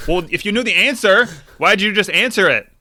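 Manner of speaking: high, loud comeback; exasperated retort. Acted. Reader answering back to a smug therapist, exasperated. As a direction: throw the question back, fed up.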